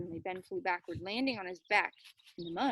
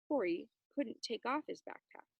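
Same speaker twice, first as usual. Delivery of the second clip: usual speaking voice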